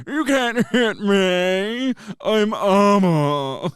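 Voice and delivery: high, loud, very drawn-out taunt; taunting Hutt character. Acted. Player as Abba taunting attackers, loud drawn-out Hutt voice. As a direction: crow it slowly in a put-on creature voice. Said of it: "just kept going".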